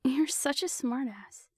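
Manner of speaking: whispered, laughing affectionate jab. A narrator's reading. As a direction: giggle it out under your breath.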